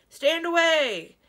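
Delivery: slow, loud, high call; shouting. Acted. Dragon in the river ordering the crowd back, loud slow shout. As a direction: stretch out a commanding shout. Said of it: "shouted someone in the river".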